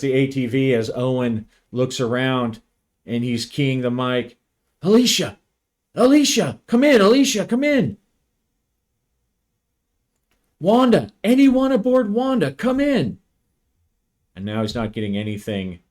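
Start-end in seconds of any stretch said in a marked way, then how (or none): 4.8-13.2 high, loud calling into the mic; urgent radio call. Acted. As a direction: shout for anyone to answer.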